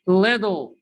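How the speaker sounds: little said as liddle; American flap t. Shown as a demonstration. Teacher drilling learners, d sound in little. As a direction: turn the tt in little into a d. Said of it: "Repeat after me".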